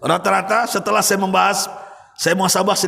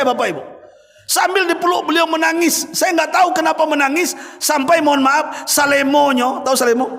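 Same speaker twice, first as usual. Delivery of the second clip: usual speaking voice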